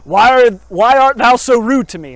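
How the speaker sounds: hammed-up archaic preacher voice; old-fashioned pastor. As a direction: play the genteel old-time parson asking why. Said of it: "That's probably how he spoke".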